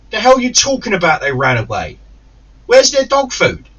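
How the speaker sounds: loud, high indignant challenge; angry disbelief. Retold or imitated. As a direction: re-enact an outraged demand, voice shooting up. Retold angry challenge to sitter.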